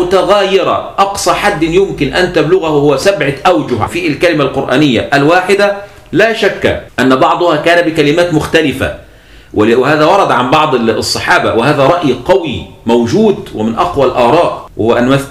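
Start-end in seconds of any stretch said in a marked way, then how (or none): none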